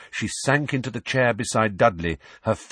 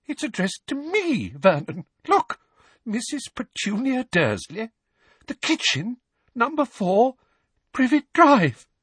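very high, panicky aunt; shaking voice; 3 dB louder than usual. Acted. Aunt showing her husband the address, shaking and horrified. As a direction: read it out in rising alarm. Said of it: "in a shaking voice".

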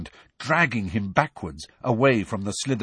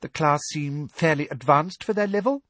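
high, brisk prim lady voice; brisk and officious; brisk Umbridge; 7 semitones higher than usual. Acted. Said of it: "she said briskly".

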